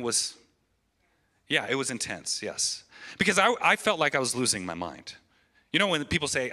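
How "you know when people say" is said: usual speaking voice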